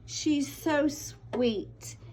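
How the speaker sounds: drawn-out tender "she's so sweet"; slow gushing praise of a kind girl; sweet, caring tone. Shown as a demonstration. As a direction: say it slow and soft like you mean it. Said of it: "compassionate is like".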